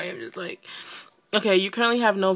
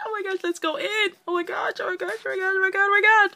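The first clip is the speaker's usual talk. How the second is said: high-pitched excited babble; squeaky freak-out building louder; giddy excitement; 13 semitones higher, 4 dB louder, faster than usual. Real, not acted. Excited rush into the game.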